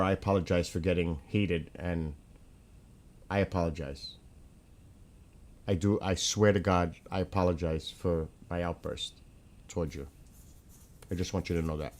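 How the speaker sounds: soft, low, sincere apology. Real, not acted. Apologizing to Skywalker for the outburst, soft and sincere. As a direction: say sorry quietly and mean it.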